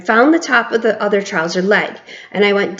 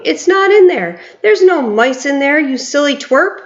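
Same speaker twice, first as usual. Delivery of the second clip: higher, jeering shout; 6 semitones higher than usual. A narrator's reading. Kitchen staff shouting at the cook, higher jeering shout. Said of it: "they were shouting".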